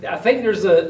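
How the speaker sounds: normal talking voice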